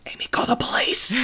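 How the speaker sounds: high, strained whispered cry; urgent whisper. Acted. Dad turns to his wife in alarm, urgent whisper.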